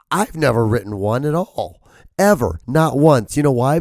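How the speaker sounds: ordinary talk, nothing marked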